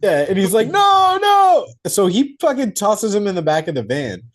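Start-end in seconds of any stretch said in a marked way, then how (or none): 0.7-1.7 high, loud mock cry of refusal; frantic shout. Will losing his mind over his hero, frantic mock shouting. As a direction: shout it out, pitched way up.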